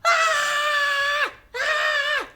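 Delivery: high, loud long screams; shriek. Acted. Mia pointing up at the ceiling, shrieking. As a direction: scream in sheer alarm, twice. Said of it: "I hear another shriek".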